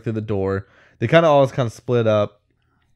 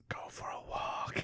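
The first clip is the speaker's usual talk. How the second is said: eerie whisper; 21 dB quieter than usual. Retold or imitated. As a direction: breathe it like a ghostly voice in his head. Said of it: "the voice again just goes".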